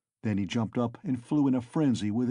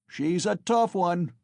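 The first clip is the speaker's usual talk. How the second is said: shouted from the crowd; 7 semitones higher, 4 dB louder than usual. A narrator's reading. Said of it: "was shouted in the crowd".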